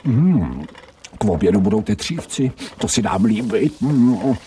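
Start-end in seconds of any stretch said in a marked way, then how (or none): 0.0-0.7 greedy hum. Acted. Dog smells the cooking. 3.8-4.3 greedy hum. Acted. Dog smells the cooking.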